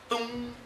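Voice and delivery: sustained, soft humming "tum"; imitated string note. Shown as a demonstration. Teacher sounding the A string for students, imitated string note. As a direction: mimic a plucked guitar string ringing.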